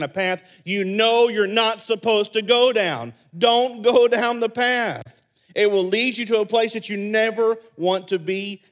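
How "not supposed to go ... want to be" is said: preaching warning. Real, not acted. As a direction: hammer the warning home. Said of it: "preaching warning".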